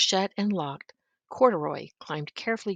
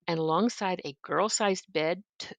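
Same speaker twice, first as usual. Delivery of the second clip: usual speaking voice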